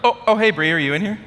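high, loud real call across room. Real, not acted. Speaker looking for wife in audience, calling out. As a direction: break off and call out brightly.

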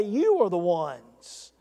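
slow, with "You" leaned on hard; emphatic you. Shown as a demonstration. Preacher driving the verse home, slow and pointed. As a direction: point the finger: "You are the ones". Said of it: "as if to say".

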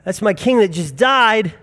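a stunned disciple catching on; dawning realization. Retold or imitated. Disciples realizing Jesus' death.

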